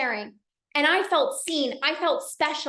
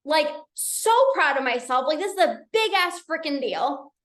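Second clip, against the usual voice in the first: ordinary delivery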